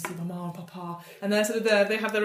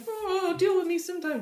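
mock taunt; 11 semitones higher than usual. GM voicing a character.

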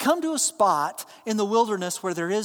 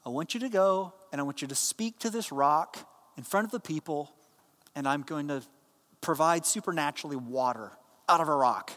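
calm; 6 semitones lower, 4 dB quieter than usual. Retold or imitated. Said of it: "God calmly says to Moses".

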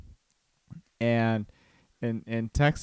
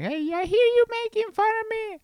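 high falsetto impression of a complaining wife; mock wife voice. Husband mimicking wife catching him teasing, mock falsetto. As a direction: send up her objection in falsetto. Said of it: "I am making fun of you".

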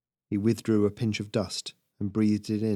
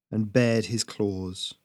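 ordinary delivery

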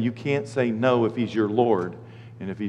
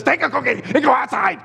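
exasperated mom; 9 semitones higher than usual. Retold or imitated.

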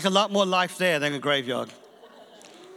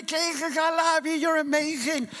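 high, quicker mock praise cry; crazy praise; 9 semitones higher than usual. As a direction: toss off the praiser's cry again, lighter and faster. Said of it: "And he's going crazy".

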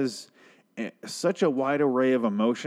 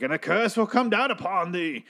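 mock butcher curse; 9 semitones higher than usual. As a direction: cast a curse like a mad butcher, way up high. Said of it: "screaming at them from the balcony as the butcher".

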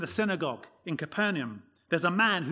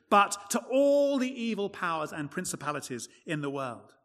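usual speaking voice

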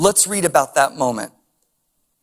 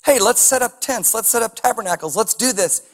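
eager Peter; 6 semitones higher than usual. Retold or imitated. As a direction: pitch the camping idea with excitement. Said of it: "Peter's like".

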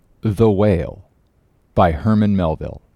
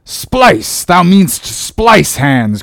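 high, loud exclamation, then drawn out; gruff captain bursting out; excited cry; 8 semitones higher, 6 dB louder, much slower than usual. A narrator's reading. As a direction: cry out in delighted correction. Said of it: "cried Peleg".

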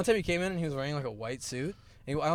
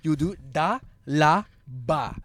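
mock singsong teacher mumble, louder; teacher's la-ba talk; 5 dB louder, much slower than usual. Student mimics math teacher explaining board, mock singsong. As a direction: imitate his lazy la ba explaining. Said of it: "He would, like, speak like that".

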